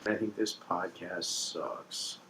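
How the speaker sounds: very soft, slow, low mumble; hushed put-on voice. Co-host putting down the show, slow and hushed. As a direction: let it drag out barely audible.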